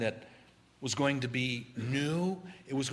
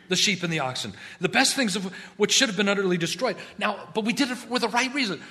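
higher, louder, fast nervous excuses; Saul making excuses; 6 semitones higher, 5 dB louder than usual. Acted. King Saul excusing himself to Samuel, high and flustered. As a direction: stammer out a justification. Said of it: "As he says".

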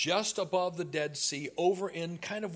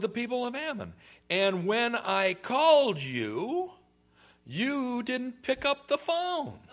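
mock indignant; 7 semitones higher, 5 dB louder than usual. Jephthah answers Ephraim, voiced.

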